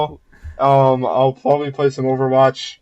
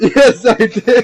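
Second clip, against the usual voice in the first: laughing; 8 semitones higher, 5 dB louder, slower than usual. Real, not acted. Deadpan answer to pun, laughing.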